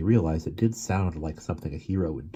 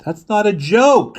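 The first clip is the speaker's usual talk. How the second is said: high, loud, slow protest; shout; 10 dB louder, slower than usual. Acted. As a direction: shout it indignantly. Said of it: "she shouted".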